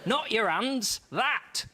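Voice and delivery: high, mild mock cry of the woman. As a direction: she points, scandalised, hammed-up. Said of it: "she cried".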